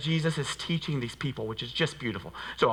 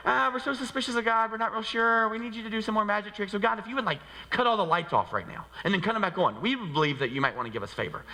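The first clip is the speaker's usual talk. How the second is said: mocking skeptic; 7 semitones higher than usual. Mocking the suspicious churchgoer.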